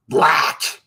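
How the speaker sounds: one loaded word, mimicking contempt; imitated disgust. Imitating how other people call you that, disgusted. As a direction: spit the word out in mock disgust. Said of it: "they say it with such disgust".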